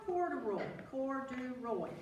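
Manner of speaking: usual speaking voice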